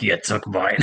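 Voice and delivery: ordinary talk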